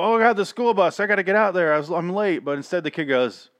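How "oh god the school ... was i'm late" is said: higher, louder hammed-up late kid; mock panicked kid. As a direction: overplay the kid who spots the bus. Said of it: "you expect him to go".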